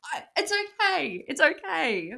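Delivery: high, slow, forced 'It's okay' to hide hurt; mock brave face. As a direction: squeak it out bravely while pretending to be fine. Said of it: "I was like".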